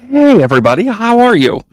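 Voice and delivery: loud greeting rising high at the end; big show greeting. Real, not acted. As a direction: call out to the whole audience. Greets viewers as show opens.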